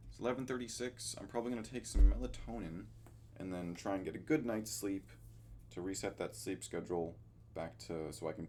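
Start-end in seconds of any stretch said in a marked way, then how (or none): none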